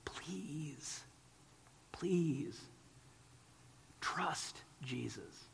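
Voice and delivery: slow, hushed pleading whisper; a soft, earnest whispered appeal; whispered plea. Real, not acted. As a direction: beg them softly to trust. Preacher's whispered plea to congregation.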